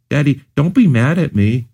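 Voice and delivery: put-on a child begging her father not to be angry; hint of a pleading little girl. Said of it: "Katrin pleaded".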